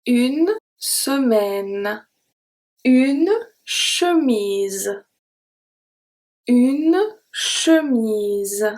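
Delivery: Une semaine very slowly, ending on the N; slow, careful une semaine; slow model word. Shown as a demonstration. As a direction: draw out semaine, final E silent. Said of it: "slow model words".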